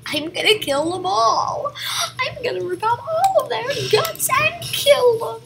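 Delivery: high cartoon villain voice; hammed-up villain. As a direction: gloat like a cartoon maniac.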